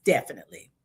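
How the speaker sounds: usual speaking voice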